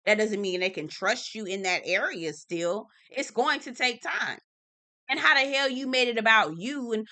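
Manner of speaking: ordinary talk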